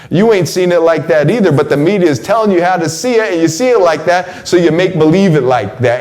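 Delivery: usual speaking voice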